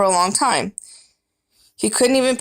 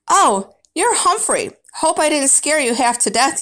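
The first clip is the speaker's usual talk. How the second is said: amused janitor; 7 semitones higher than usual. Acted. Janitor chuckles at the hamster.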